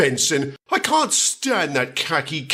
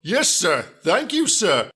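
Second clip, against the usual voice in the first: ordinary talk, nothing marked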